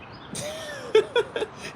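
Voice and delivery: high-pitched wheezy laughter; laughing hard. Real, not acted. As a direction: burst into a high, squealing laugh. Amused by the salt joke.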